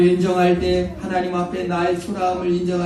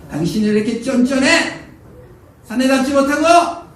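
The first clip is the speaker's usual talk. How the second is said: much higher and louder, a sneering jab; taunting wife; 9 semitones higher, 6 dB louder than usual. Retold or imitated. Wife mocking her husband's manliness, high taunting jab. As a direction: needle him where it hurts. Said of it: "아내가".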